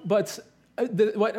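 ordinary delivery

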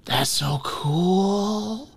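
put-on a wide-eyed kid in awe; awed little boy.

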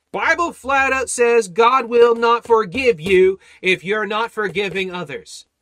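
loud, slowed, high warning; preaching shout. Acted. Preacher warns the congregation, shouting. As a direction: thunder the warning at them.

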